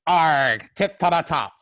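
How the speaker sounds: pirate voice. Pretend. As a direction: announce it like a pirate captain. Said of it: "get my pirate voice ready".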